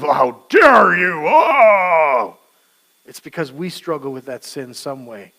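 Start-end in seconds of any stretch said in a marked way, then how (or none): 0.0-2.4 slow, loud, wailing outrage; indignant how dare you. Retold or imitated. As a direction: drag it out in mock offense. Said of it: "this attitude of".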